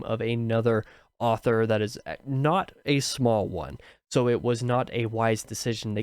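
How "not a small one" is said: usual speaking voice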